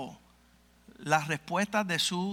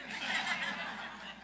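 laughing; 12 semitones higher, 9 dB quieter than usual. Real, not acted. Laugh at the sister-in-law's reply.

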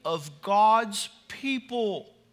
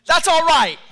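loud, high approving call-out; congregation shout; 8 semitones higher, 12 dB louder than usual. Shown as a demonstration. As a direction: stand up and holler it to the preacher. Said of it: "they'll stand up and they'll say".

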